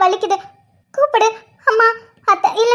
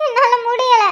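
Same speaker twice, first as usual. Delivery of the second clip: sobbing, pained complaint; crying in pain. Acted. Woman in labour tells grandmother she can't bear it, crying. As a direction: cry it out between sobs. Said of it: "அவ அழுகுறா பாரு".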